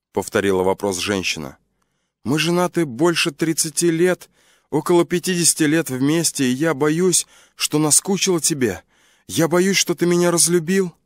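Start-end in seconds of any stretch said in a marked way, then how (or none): 2.3-10.9 higher, lightly voiced anxious wife; worried wife. Wife asking husband if he still loves her, anxious. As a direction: she fears he stopped loving her.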